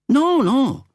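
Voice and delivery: very high, loud protest; insisting. A narrator's reading. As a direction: shoot up high, excited. Man in lift insisting.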